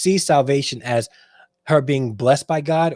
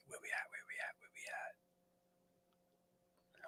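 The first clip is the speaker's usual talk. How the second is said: barely audible whispered mutter; whispering to self; 25 dB quieter, much faster than usual. Real, not acted. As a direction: whisper it absently to yourself while searching. Muttering to self while searching screen.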